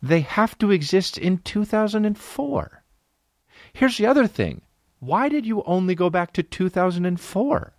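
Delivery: high-pitched incredulous complaint; mock exasperation. Customer confronting an outlet clerk about dated clothes, mock exasperated. Imagined challenge to store clerk.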